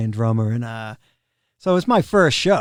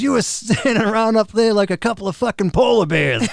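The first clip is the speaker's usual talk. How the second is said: drill sergeant; 7 semitones higher than usual. Retold or imitated. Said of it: "like a drill sergeant".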